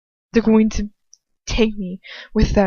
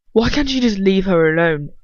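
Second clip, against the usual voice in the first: a louder, furious shout at Lucius; 6 dB louder than usual. Acted. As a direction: yell it at him, fed up. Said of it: "Ron shouts at him".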